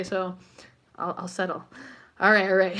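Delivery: ordinary delivery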